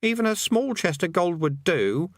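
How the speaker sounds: high, longing plea; a touch of wistful yearning; pleading. Acted. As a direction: beg with growing longing. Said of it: "edge of pleading in his voice".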